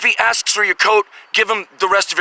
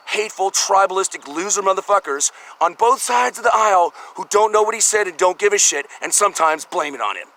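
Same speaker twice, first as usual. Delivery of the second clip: ordinary talk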